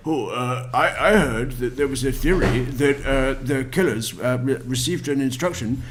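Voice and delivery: mild mock imitation of a gullible fan; mocking simp voice. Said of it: "and say".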